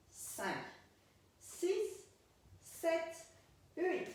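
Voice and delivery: spaced-out, rhythmic beat counting; dance teacher's count. Shown as a demonstration. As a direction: count the beats aloud, one word per move.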